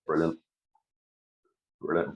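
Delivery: ordinary talk, nothing marked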